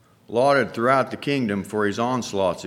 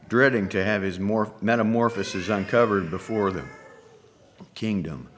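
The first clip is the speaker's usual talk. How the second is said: usual speaking voice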